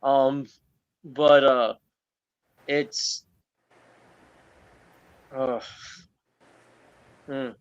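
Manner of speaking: trembling, choked-up words; shaky voice. Real, not acted. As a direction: voice shaking, holding back tears. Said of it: "I'm actually shaking".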